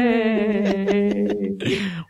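high-pitched giggle; high laugh. Real, not acted. Laughing at ghost joke.

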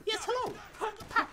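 high, bouncy put-on soldier voice; Mason mode. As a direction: play a cheerful, goofy Mason picking off enemies. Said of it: "Mason, activate mode".

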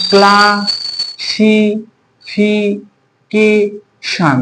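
Classification in five long, level syllables with gaps. Shown as a demonstration. Teacher asking students to count parts, slowly split classification. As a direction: chant out classification very slowly as kla, si, fi, ke, shon. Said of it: "এটা কয় ভাগে পাচ্ছি".

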